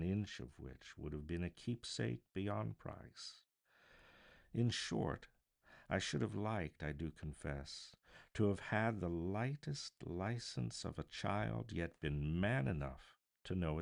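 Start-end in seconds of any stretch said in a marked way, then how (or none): none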